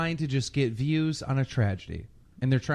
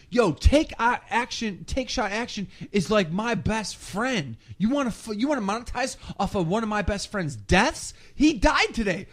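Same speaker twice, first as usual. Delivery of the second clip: a touch higher, mock grieving rant; mock upset vlogger; 7 semitones higher than usual. Keemstar imitating a vlogger claiming a dead friend. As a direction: play a hammed-up offended friend. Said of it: "He goes".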